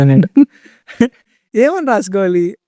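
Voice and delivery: laughing through the words. Real, not acted. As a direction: chuckle while asking the question again. Retelling funny scooter question. (నవ్వుతూ అడగడం)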